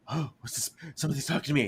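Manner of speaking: a nervous hush at an unseen voice; hushed scared. Retold or imitated. Gino alone hearing a ghostly voice behind him, hushed.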